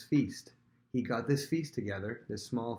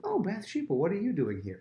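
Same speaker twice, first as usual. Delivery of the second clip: mock surprise; 10 semitones higher, 4 dB louder than usual. David feigns surprise.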